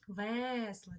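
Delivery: Весла with a long, loud first Е; stressed, stretched Е. Shown as a demonstration. Teacher showing children the sound to write, Весла with stretched Е. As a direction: hold the Е in Весла so it stands out. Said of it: "Чуємо Е".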